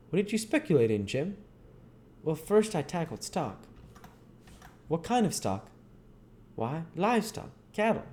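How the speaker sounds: chatty back-and-forth in dialect; Huck and Jim talking. Acted. Dialogue between Huck and Jim.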